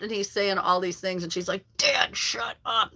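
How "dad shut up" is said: slow, drawn-out mock protest; imitating mortified daughter. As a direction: act the embarrassed kid, stretching each word. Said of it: "how mortified she was".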